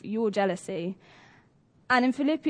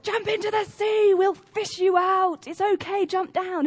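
high, loud call through a megaphone; rescuer shouting; 9 semitones higher, 4 dB louder than usual. Retold or imitated. Rescue crew calls to the man on the cliff, high megaphone shout. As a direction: bellow it down from the boat. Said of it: "they're like".

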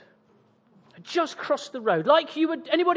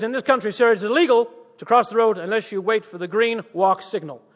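imitated American police officer; American accent; 2 semitones lower, 4 dB louder than usual. Officer explaining the law to a tourist, American accent. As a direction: recite the rule in a mock American accent. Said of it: "That's an American accent".

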